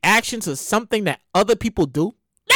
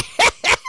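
a burst of whooping laughter; cookout whoop laugh. Pretend. Calling out at the cookout.

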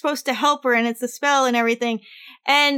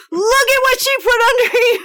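high, loud, fast hammed-up outrage; mock outraged shout; 10 semitones higher, 7 dB louder, faster than usual. Stepfather showing the wife the mandrake, hammed-up loud outrage. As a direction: blurt it out in a high mock fury. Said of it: "And he's like".

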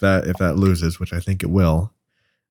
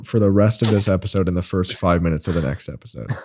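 usual speaking voice

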